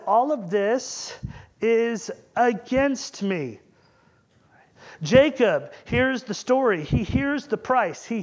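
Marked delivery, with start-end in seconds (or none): 0.1-3.6 slow, drawn-out mock lament. Jacob laments to his sons, slow and drawn out. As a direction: stretch each word out like a weary old father. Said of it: "He says".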